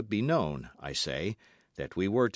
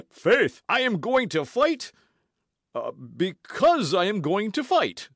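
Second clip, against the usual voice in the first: loud, high-pitched blustering; flustered bluster; 12 semitones higher, 8 dB louder than usual. A narrator's reading. Porthos dodges Athos's question about the duel, reddening and blustering.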